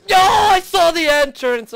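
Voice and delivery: high, loud wail; anguished yell. Real, not acted. Player losing near the entrance, anguished yell. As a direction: cry it out in dismay.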